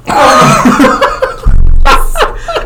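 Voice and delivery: high, loud burst of laughter; helpless high cackle; real laugh. Real, not acted. Laughs at fart talk.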